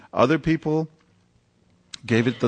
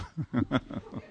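a short breathy laugh; real laugh; 3 semitones higher, 10 dB quieter than usual. Real, not acted. Reporter laughing off a bigot's jab, short laugh. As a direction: laugh off the insult.

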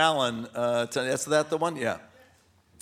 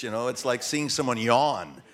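normal talking voice

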